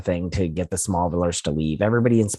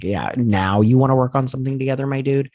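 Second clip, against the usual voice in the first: sarcastic retort; 6 semitones higher than usual. Pretend. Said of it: "And I'm like".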